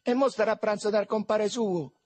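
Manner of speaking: high, loud street banter; loud, high-pitched boyish heckle; shouted jibe. Acted. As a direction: holler the jibe to your mates. Boys shouting jibes to each other.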